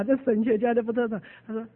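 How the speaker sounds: normal talking voice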